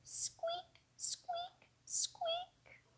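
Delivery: tiny whispered squeaks; high whispered mouse squeaks. Pretend. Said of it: "Hear its funny sound".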